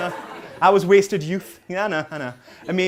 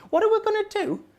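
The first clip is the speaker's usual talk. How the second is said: much higher-pitched panicked plea; acted panic; 13 semitones higher than usual. Flatmate asking his wrestling partner after the botched jump, panicked. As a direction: let the question leap up into a squeaky panic.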